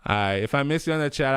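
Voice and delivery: ordinary delivery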